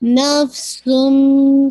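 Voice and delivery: very slow نفسم with a long hummed meem; full nasal ghunna. Shown as a demonstration. Teacher showing complete ghunna to students. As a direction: hold the nasal hum on نفسم. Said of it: "آپ نے غنہ کرنا".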